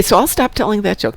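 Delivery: ordinary talk, nothing marked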